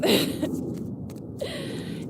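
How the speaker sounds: a high airy giggle; high breathy laugh. Real, not acted. Reader laughing after telling viewers to cry, amused laugh. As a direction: let out a high unvoiced laugh.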